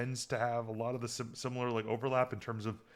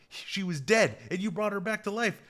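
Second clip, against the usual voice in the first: retold astonishment, pitched up; mock incredulous, higher on the second half; amazed disbelief; 7 semitones higher than usual. Retelling Kira's amazed reaction.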